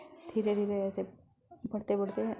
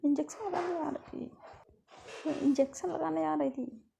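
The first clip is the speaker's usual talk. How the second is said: higher, small pleading voice; timid excuse; 7 semitones higher than usual. Acted. Girl explaining she threw the pillow at the nurse, timidly. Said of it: "तो कहती".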